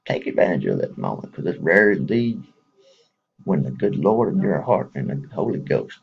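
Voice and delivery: ordinary talk, nothing marked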